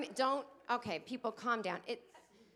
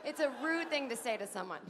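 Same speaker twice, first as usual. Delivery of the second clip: ordinary talk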